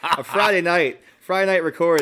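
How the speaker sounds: laughing while teasing; bright, laughing tease. Real, not acted. Teasing host, laughing loosely.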